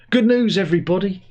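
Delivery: high, loud mock cheer; sarcastic. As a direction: announce it with fake delight. Sarcastic intro to strikes.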